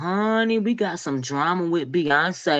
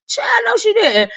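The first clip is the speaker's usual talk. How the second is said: high squeal of mock outrage; high shocked exclamation; 3 dB louder than usual. Vlogger gasping at the friend's tweet, high squeal.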